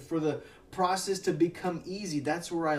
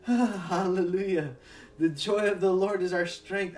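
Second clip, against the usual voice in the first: ordinary delivery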